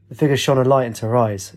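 ordinary delivery, nothing marked

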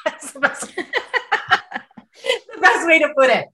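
laughing through the words, high and slow. Real, not acted. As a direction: laugh out the line while telling it. Laughing at her own phrasing.

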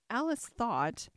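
ordinary delivery

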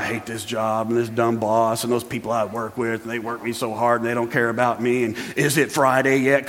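low, soft grumble of a fed-up worker; grumbling employee. Retold or imitated. Preacher mimics an employee grumbling low about the boss. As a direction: mutter like you hate your job. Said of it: "grumble and complain about".

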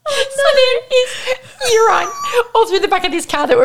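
high, loud, squealing through laughter; laughing hard. Real, not acted. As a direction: barely get it out for laughing. Helpless laughter at the disaster.

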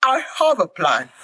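high, slow, anxious cry; pleading husband. Acted. Husband alligator begging his wife not to die, high anxious cry. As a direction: beg her not to die.

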